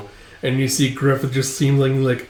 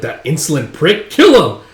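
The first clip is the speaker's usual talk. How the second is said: egging on a killing, louder on the last words; acted angry yell; 3 semitones higher, 6 dB louder than usual. Band watching the duel calls for blood, angry yell. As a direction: build to a high, loud cry.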